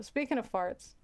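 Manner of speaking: usual speaking voice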